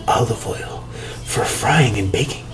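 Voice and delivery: whispered label text. Real, not acted. Reading the olive oil label to viewers, whispered. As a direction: breathe it like a secret.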